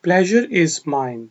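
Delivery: slow, careful model of "Pleasure is mine"; deliberate teaching pace; slow model phrase. Shown as a demonstration. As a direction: stretch it out for students to repeat. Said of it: "ये मेरे लिए खुशी की बात है।".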